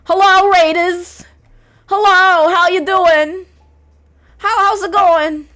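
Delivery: high, loud, drawn-out hollering; shouted welcome. Real, not acted. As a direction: holler a big stretched-out hello to a crowd. Hailing arriving raiders at full volume.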